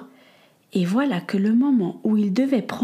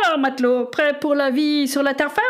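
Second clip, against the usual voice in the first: jolly cook; 5 semitones higher than usual. Acted. Cook teases Samuel.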